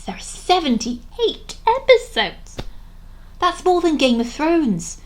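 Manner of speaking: high, slow, drawn-out incredulity; aghast disbelief. Real, not acted. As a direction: exclaim it high, stretching the shocking number. Aghast at the episode count.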